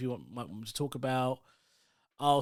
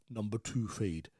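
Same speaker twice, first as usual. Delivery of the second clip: low, softer street-accent mumble; mumbled barbershop accent; 6 semitones lower, 6 dB quieter than usual. Shown as a demonstration. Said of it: "that kind of mumbling".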